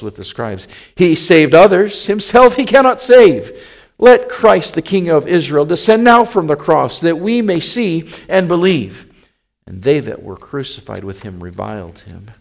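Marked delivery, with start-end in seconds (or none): 1.0-9.1 loud, high-pitched jeer; mocking. A narrator's reading. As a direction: taunt him with raised voice. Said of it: "the chief priests mocking said".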